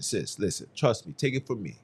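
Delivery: ordinary delivery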